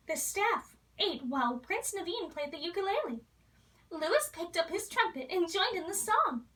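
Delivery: ordinary delivery, nothing marked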